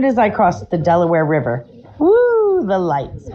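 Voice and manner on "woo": high, drawn-out "woo" siren; imitated police siren. Telling friends about being pulled over, imitated siren. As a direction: wail it like a siren going off.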